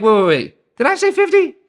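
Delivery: sudden high squeak on fifty; backpedalling in a startled high voice; caught out. Retold or imitated. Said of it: "Abraham's like".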